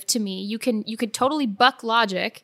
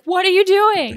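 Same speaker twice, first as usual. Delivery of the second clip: shocked yell; 9 semitones higher, 6 dB louder than usual. Retold or imitated. Retelling Step Brothers scene, Will Ferrell.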